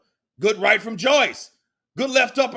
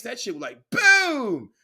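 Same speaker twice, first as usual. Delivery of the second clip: loud sudden boom for the punch; 3 dB louder than usual. Retold or imitated. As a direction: slam out the impact. Imitating punch impact.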